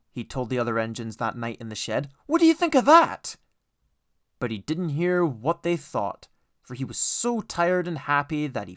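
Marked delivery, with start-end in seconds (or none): 2.3-3.4 high, loud teasing question; gloating. A narrator's reading. Edward gloating to the other engines, high and teasing. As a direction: tease them proudly.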